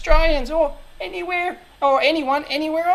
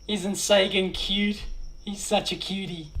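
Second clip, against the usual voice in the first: doting pet voice; 4 semitones lower, 4 dB quieter than usual. Real, not acted. Owner coos over photo of his pet Sagan, softly doting. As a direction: coo fondly at the picture.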